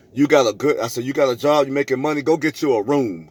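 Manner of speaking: normal talking voice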